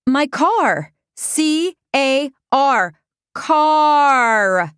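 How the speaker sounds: high, insistent and slow, letter by letter; exasperated spelling out. A narrator's reading. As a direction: spell it out as if they don't understand. Spelling it out, exasperated, to laughing men.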